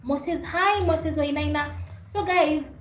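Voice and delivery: normal talking voice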